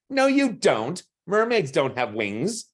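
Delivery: put-on high child voice, insistent; know-it-all kid. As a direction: correct her like a smug little boy.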